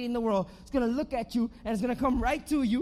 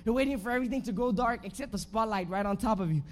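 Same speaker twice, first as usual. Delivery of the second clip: ordinary talk, nothing marked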